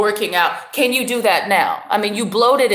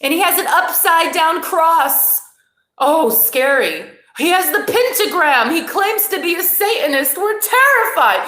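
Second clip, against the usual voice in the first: mock fear; 7 semitones higher than usual. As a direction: ham up fake fright at the mask.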